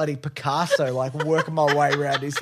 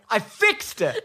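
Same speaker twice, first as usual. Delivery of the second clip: proud; 13 semitones higher, much slower than usual. Real, not acted. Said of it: "proud claim".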